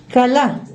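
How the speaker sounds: "Kalá" said alone and clearly, stress on the final A; Hebrew word spoken. Shown as a demonstration. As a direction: spell out "Kalá" as ka-LA. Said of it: "Kalá como suena".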